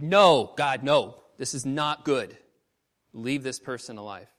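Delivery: loud protest easing into a plea; arguing with God. Retold or imitated. Preacher pleading for someone near death, loud retold protest. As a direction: push back hard, then ease off. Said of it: "I'm arguing with God".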